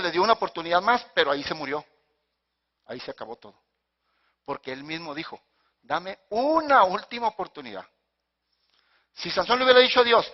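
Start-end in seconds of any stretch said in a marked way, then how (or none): 5.8-7.9 UNA stretched long, high and loud; stress on UNA. Retold or imitated. Quoting Samson's limited request, UNA drawn out high. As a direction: lean hard and long on "una".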